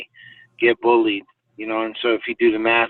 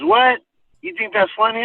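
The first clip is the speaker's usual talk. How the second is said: challenging friend; 11 semitones higher than usual. Retold or imitated.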